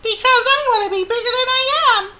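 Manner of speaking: very high, loud wailing tiny mouse; Squeak. A narrator's reading. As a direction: squeal it as a tearful little mouse. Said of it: "explained Squeak".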